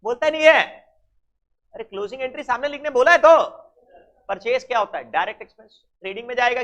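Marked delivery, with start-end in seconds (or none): 0.0-0.6 louder, higher scolding at a silent class; frustrated teacher demanding an answer; exasperated scold. Real, not acted. 1.7-3.4 fast, louder and higher complaint; irritated teacher repeating the instruction; impatient scold. Real, not acted. Teacher scolding silent class.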